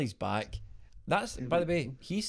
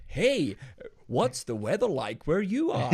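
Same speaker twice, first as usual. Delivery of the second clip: radio DJ voice; 3 semitones higher, slower than usual. Retold or imitated. Imitating the Clyde One host asking the caller, hammed-up DJ. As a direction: ham up a local radio phone-in host. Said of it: "that thing that they do on Clyde One".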